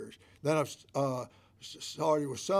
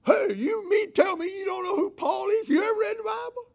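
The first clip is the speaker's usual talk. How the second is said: much higher, louder hammed-up disbelief; mock outraged shout; 7 dB louder than usual. Preacher pretends to scold the lady, hammed-up shouting. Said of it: "No, I didn't say that".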